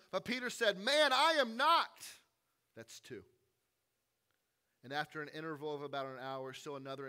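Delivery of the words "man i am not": high, loud, slow denial; sharp denial. Retold or imitated. Peter denying he knows Jesus, loud and high. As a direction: bite off the denial.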